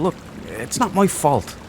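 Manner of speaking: ordinary talk, nothing marked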